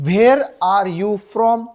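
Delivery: very slow, spaced-out model sentence; slow teaching model. Shown as a demonstration. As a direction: say each word slowly for learners. (शिकवण्यासाठी अगदी सावकाश)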